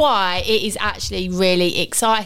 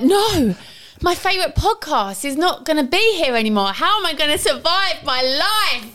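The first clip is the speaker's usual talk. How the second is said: mock dramatic listener; 7 semitones higher than usual. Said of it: "dramatic pause of like".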